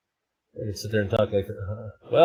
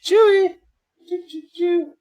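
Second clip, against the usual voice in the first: high, loud call to the cat; high sing-song cat call; 3 dB louder than usual. Real, not acted. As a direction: call the cat from across the house. Calling the cat.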